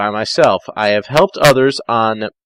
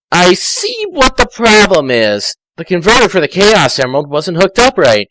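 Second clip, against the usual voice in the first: lightly voiced Tails; 6 semitones higher, 3 dB louder than usual.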